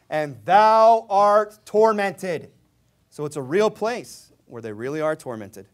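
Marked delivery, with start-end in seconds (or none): 0.1-2.5 slow emphatic preaching. Acted. Preaching at full force.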